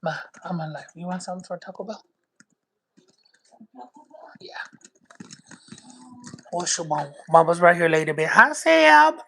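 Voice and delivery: a deep-voiced mom asking her kid for Taco Bell orders. Low mama voice taking the Taco Bell order.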